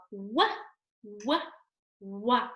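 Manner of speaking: "Wah, wah, wah" as clipped phonics sounds; letter W sound. Shown as a demonstration. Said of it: "how we write the letter W".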